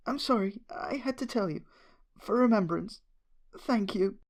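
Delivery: a gentle mare apologising through tears; high tearful thanks; tearful Fluttershy. Acted. Fluttershy crying over a death.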